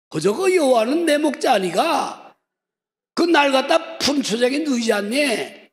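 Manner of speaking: high, loud North Korean dialect psalm. Shown as a demonstration. Pastor doing the psalm in North Korean dialect, high. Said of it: "이북 말라면".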